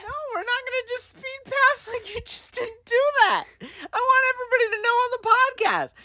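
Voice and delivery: high, indignant protest; mock outrage. Real, not acted. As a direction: act scandalized at the slap.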